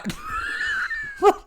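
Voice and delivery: very high shrieking laugh; a squealing laugh at herself; burst of laughter. Real, not acted. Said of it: "My accents are all over the place".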